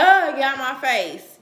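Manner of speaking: put-on loud dismissive snap at a child; dismissive parent. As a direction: wave the kid off loudly. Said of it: "you're like".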